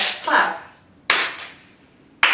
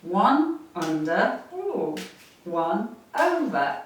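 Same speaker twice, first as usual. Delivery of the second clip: slow counting cue; 2 semitones lower, 4 dB quieter, much slower than usual. Shown as a demonstration. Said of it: "You got it".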